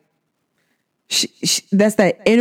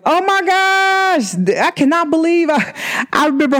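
giddy gush; 9 semitones higher than usual. Retold or imitated.

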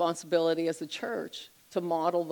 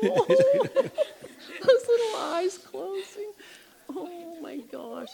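cooing; 13 semitones higher, 4 dB louder, much slower than usual. Real, not acted. Cooing over a baby.

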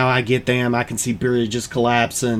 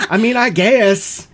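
ordinary talk

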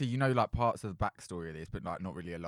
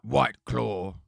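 loud, slow, mock-butch brand name; 7 dB louder than usual. Joking that the drink's name sounds butch, mock-butch voice. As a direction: say it big and butch, drawn right out. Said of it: "it sounds quite butch".

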